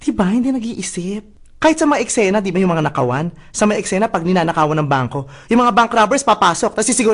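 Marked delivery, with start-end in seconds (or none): none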